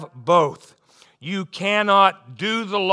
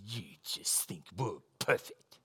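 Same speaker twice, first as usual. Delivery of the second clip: put-on whispered child's complaint; 14 semitones lower, 16 dB quieter than usual. Little sister complaining to older sister.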